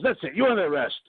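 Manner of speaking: mock trooper snapping an arrest; retold cop ordering him out. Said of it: "So he goes".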